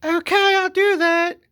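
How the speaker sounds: very high, loud mock lady voice; falsetto woman. Wife asking security to unload the husband, in a high falsetto. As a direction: chirp it sweetly like nothing happened. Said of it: "she was like".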